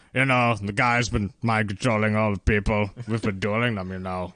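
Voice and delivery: lightly voiced masked wrestler; a hint of Strong Bad growl. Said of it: "I'm starting to go into strong bad voice".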